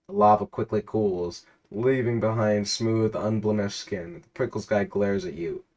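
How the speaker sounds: measured storyteller read of a game scene; reading out the game's story to a friend, narrator voice; story narration. A narrator's reading. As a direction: narrate the adventure steadily.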